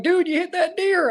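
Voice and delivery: high mocking buddy voice; high teasing buddy impression; buddies' voice. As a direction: squeak it out like a teasing friend. Buddies quoted mocking him.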